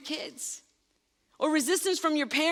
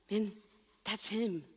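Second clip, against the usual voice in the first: usual speaking voice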